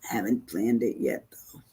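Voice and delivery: confiding whisper to the audience. Real, not acted. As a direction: let it slip out as a secret. Confiding aside about cruise plans.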